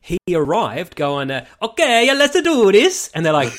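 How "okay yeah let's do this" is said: high, loud, hammed-up Italian plumber voice; Mario voice. Chris Pratt on day one trying his Mario voice, hammed-up and loud. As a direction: burst in with a big cartoony Mario voice. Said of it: "I'm doing a voice. I'm gonna do the Mario".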